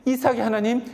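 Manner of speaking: ordinary delivery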